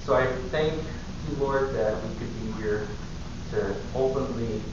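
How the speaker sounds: soft, earnest prayer voice, fading to a near-whisper at the end. Acted. Leads a prayer before sermon.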